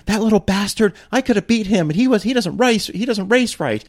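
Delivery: mock her petulant whining; a hammed-up sore loser's whine; whiny complaint. Friend's angry complaint, voiced high.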